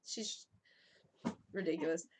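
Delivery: ordinary talk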